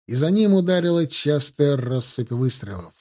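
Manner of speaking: plain reading voice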